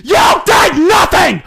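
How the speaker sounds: high, very loud, building rage; furious shout. Acted. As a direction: yell it, angrier with every line. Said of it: "He started growing angrier by the second".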